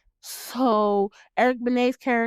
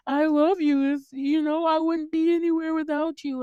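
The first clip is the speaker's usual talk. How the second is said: high-pitched, sappy imitation; mock lovestruck girlfriend; 7 semitones higher than usual. Vlogger imitating Billy sitting with Dice, mock lovestruck. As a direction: mimic a doting girlfriend in a raised voice.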